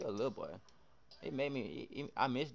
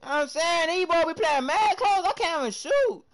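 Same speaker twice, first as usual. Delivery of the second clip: frustrated; 11 semitones higher, 7 dB louder, slower than usual. Real, not acted. Frustrated at opponents.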